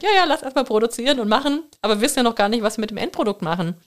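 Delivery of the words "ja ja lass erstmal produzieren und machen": mock careless voice. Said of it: "dass man sagt".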